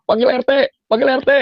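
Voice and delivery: high mock shout for the neighbourhood head; imitating furious parents. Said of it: "udah kesel banget gitu loh".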